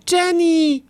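high, loud shout of a name; drawn-out alarmed holler; yelled call. A narrator's reading. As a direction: bellow for a missing sister. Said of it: "brüllte er".

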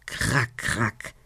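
low raven croak; raven caw. A narrator's reading. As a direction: caw twice, low in pitch. Raven caws in indignation. (tiefes Rabenkrächzen)

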